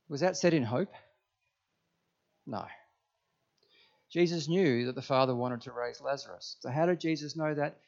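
ordinary talk

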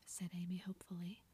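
ordinary delivery, nothing marked

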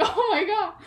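high, loud, laughing outburst; mock exasperation. Actor recalling her struggle with the words, laughing exasperation. Retold exasperation at the word trouble.

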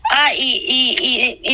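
a sob bursting out before the words; real tears choking the start of the line; crying. Real, not acted. Woman sobbing as she tells the church her story, crying. Weeps recalling that Sunday.

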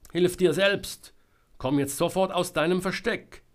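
loud, high scolding command; shouted order. A narrator's reading. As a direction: shout back sternly at someone hiding. Selma shouts back at Georg. (laut befehlend zurückrufen)

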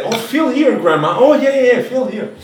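high-pitched mock female relative; hammed-up, high excited chatter; imitating mother. Mother showing grandma what she found, high mock chatter.

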